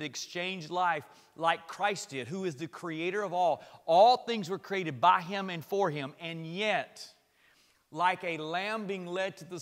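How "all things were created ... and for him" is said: ordinary delivery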